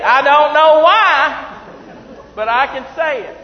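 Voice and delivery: very high, loud, stretched-out cry; exultant shout. Real, not acted. Preacher marvelling he can pronounce the word, exultant holler. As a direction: holler it slowly, thrilled and amazed.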